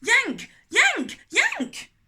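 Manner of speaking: very high, loud rising yanks; three escalating shouted yanks. Acted. Three strings yanked.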